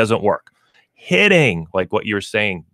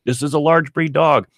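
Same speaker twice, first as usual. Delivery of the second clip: protesting objection; 7 semitones higher than usual. Acted. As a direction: imitate a listener objecting, pitched up. Voicing a doubtful listener's objection.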